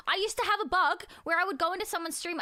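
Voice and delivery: ordinary delivery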